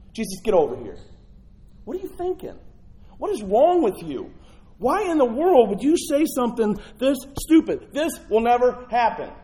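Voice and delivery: loud, high, scolding disbelief; exasperated rebuke. Acted. Peter pulling Jesus aside to scold him, loud and exasperated. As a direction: chew him out like a frustrated friend.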